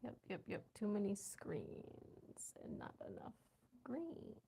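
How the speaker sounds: soft breathy whisper of an aside. Real, not acted. Streamer whispers a joke aside to her viewers. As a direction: whisper it like a secret to chat.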